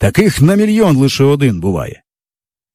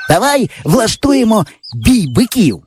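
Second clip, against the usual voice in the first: put-on raised boyish voice; eager boy; 9 semitones higher than usual. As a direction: pitch the idea with glee. Yava proposes bullfight. (хлопчина захоплено пропонує)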